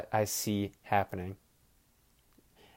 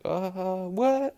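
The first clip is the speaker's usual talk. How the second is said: high, stretched "what?" of a baffled defender; a sudden high, puzzled "Uh, what?"; 9 semitones higher than usual. Retold or imitated. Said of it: "he just kinda like".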